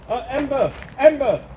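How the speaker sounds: high, ringing call across the field; calling the dog. Real, not acted. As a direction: sing out her name to fetch the dog back.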